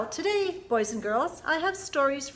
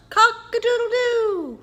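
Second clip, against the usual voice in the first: high loud cock-a-doodle-doo; rooster crow; 10 semitones higher, 7 dB louder than usual. Shown as a demonstration. Librarian crows for children like a rooster, high and loud. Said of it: "Wake up and shout".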